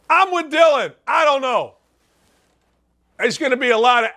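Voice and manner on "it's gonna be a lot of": ordinary talk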